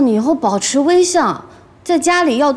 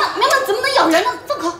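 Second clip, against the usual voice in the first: 急喊; 7 semitones higher, much faster than usual. Acted. 急着制止孩子咬人.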